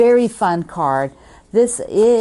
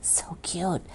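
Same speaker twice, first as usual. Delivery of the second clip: so cute whispered with no voice at all; 13 dB quieter than usual. Real, not acted. Admiring a friend's gift card, in a whisper. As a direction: hush it to a delighted whisper.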